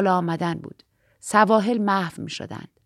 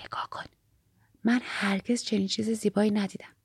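hushed wonder, very soft; soft, awed murmur; whispering; 9 dB quieter than usual. A narrator's reading. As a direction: whisper it in awe to your companion. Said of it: "اگنس پچ پچ کنان گفت".

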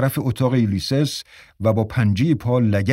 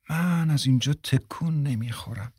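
calm, softer and unbothered; relaxed; 3 semitones higher, 6 dB quieter than usual. A narrator's reading. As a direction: lazily refuse to budge, softly. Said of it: "با آسودگی دراز کشید".

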